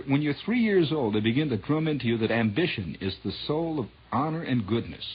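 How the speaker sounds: ordinary talk, nothing marked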